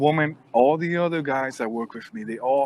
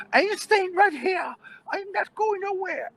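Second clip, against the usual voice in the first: put-on very high, squealing old-lady voice; defiant old woman. Woman daring guard to call police, high defiant squeal. Woman refusing while dragged out.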